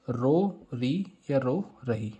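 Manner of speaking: slow, separated रो, री, then रो रही; split syllables. Shown as a demonstration. Teacher explaining the memory trick, slow and separated रो री. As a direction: draw out रो री piece by piece. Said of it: "ये आखिरी वाली word रो री".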